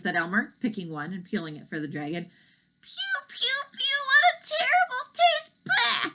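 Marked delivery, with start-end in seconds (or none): 2.8-5.5 very high, disgusted dragon spitting; choking dragon. Acted. Dragon tastes a tangerine, very high and disgusted. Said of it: "choked the dragon". 5.6-6.1 loud high spitting bah; disgusted bah. Acted. Dragon spits out tangerine with a high bah. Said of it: "choked the dragon".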